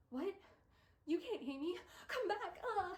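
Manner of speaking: hammed-up pleading, pitch shooting up; mock desperate Will. Book boyfriend begging Tessa to come back, hammed-up high wail. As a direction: voice climbing high and loud in fake despair.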